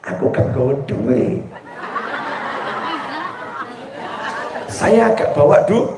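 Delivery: sheepish whisper. Acted. Husband quietly admits to wife he brought no money. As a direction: murmur it ashamed. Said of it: "Suaminya terus bisik".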